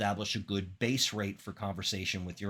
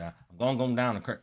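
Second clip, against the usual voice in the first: put-on Southern Florida accent; 3 dB louder than usual. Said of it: "people have an accent".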